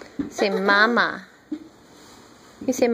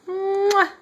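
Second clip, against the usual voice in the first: kiss sound; 6 semitones higher than usual. Real, not acted. Blowing a kiss to baby.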